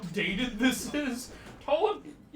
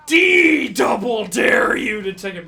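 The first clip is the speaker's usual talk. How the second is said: slow, loud, higher mock bully shout; big escalated dare; 4 semitones higher, 13 dB louder than usual. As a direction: boom out the ultimate dare.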